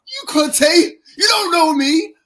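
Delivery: loud, very high shout, close to crying; hurt outburst. Retold or imitated. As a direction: yell at Conte like a wounded fan. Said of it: "I was like".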